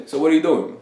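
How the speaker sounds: casual, unbothered question; offhand, level delivery; blasé. Shown as a demonstration. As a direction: toss it out as if barely interested. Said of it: "Like, in a very blasé".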